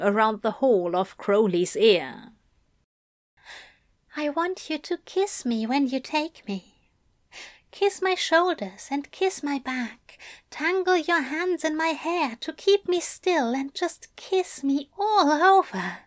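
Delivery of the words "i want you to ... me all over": Aziraphale, seductive. A narrator's reading. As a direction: coax him gently, drawing each phrase out. Aziraphale's lines to Crowley.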